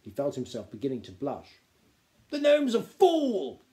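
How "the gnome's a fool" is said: indignant duke, higher and louder; loud, scornful dismissal; snapped outburst. A narrator's reading. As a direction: snap it out, stretching the words. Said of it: "snapped the Duc de Haberdashery".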